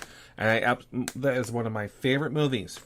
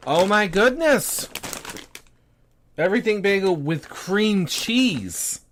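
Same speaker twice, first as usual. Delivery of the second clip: surprised delight; 7 semitones higher, 5 dB louder than usual. Real, not acted. Tasting a surprising chip flavor.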